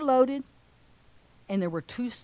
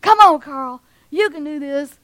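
family cheering; 3 semitones higher, 8 dB louder than usual. Acted. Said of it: "they're going".

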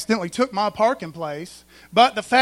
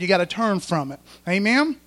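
ordinary talk, nothing marked

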